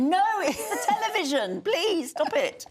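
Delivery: high laughing call to the dog; half-laughing, pleading yell. Retold or imitated. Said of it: "I have to just keep yelling at her".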